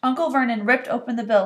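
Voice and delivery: ordinary delivery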